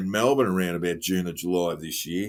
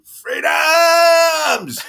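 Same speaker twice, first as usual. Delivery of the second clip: mock wailing; 9 dB louder than usual. Mock dramatic wail.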